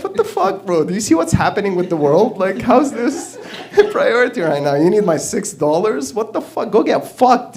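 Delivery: high-pitched, incredulous protest; exasperated outburst. Acted. Comedian to border agent over six dollars, exasperated. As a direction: rant in mock outrage at a petty request.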